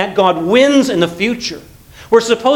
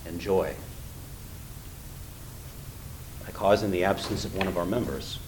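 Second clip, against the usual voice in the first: hushed, sombre; 11 semitones lower, 15 dB quieter than usual. Real, not acted. Sombre lament over church member's absence.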